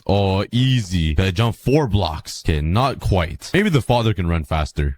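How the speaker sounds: ordinary talk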